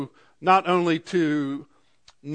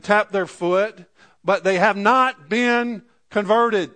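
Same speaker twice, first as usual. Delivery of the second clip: fervent preaching; 5 semitones higher, 4 dB louder than usual. Acted. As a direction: drive it home from the pulpit. Preacher building to full force.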